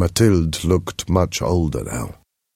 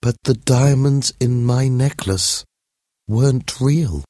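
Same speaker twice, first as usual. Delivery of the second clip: high lady voice, gently breaking news; a lady breaking hard news, slowing at the end; lightly voiced lady; 7 semitones higher, slower than usual. Telling Mathilde the necklace was fake, high and gentle.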